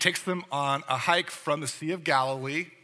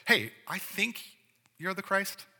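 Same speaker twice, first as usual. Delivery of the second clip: tentative opinion; 6 semitones higher than usual. Retold or imitated. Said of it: "He doesn't say".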